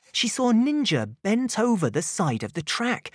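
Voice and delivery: usual speaking voice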